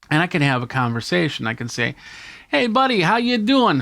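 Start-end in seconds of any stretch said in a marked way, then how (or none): 2.5-3.8 high, bright mock greeting to a guest; cheery interviewer greeting. Vlogger greeting his interview guest, much higher and cheery. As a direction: play the chummy host saying hi.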